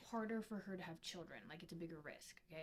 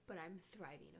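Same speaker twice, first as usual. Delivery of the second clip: usual speaking voice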